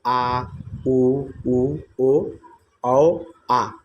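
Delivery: आ उ ओ औ stretched slowly, each held apart; vowel demo. Shown as a demonstration. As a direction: slowly sound out the back vowels आ उ ओ औ. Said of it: "पश्च स्वर। जैसे". (पश्च स्वर आ उ ओ औ धीरे-धीरे खींचकर बोलना)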